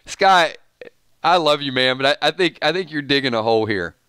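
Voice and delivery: ordinary delivery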